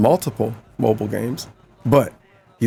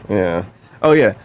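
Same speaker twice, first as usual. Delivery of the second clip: ordinary talk, nothing marked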